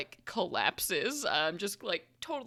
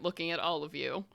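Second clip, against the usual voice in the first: usual speaking voice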